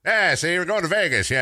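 mock old-time gangster, see; old-time thirties gangster voice. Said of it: "old-time thirties guy".

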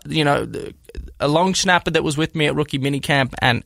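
ordinary talk

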